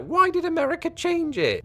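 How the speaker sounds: high-pitched mock-indignant question; put-on high complaining voice; British complainer. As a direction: ask it way up high, imitating a baffled Brit. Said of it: "I often hear British people saying things like".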